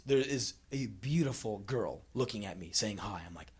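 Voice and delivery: ordinary talk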